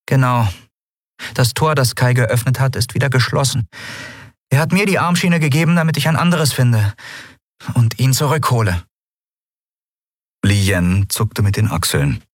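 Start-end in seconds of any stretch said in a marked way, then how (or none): 0.0-8.8 a hint of an earnest young heroine, higher; lightly acted explanation, raised pitch; lightly voiced Endriel.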